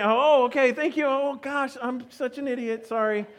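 high-pitched, sheepish retold apology; flustered apology. Acts out embarrassed apology to driver.

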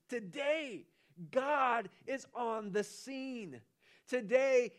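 Today lifted high, then slow God is on the scene; stress on today. Real, not acted. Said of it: "preacher stressing today".

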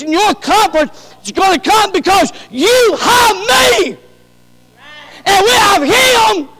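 loud, high, driving sermon delivery; full-force preaching. Acted. Preacher rousing the congregation with a loud sermon. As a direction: preach it out with rising fervour.

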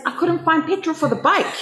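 high imitated American accent; lightly mimicked American voice, high. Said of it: "in his American accent".

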